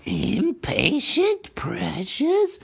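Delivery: high, slow hissing creature voice; Gollum hissing. A narrator's reading. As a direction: hiss it slyly at the hobbit. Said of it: "hissed Gollum".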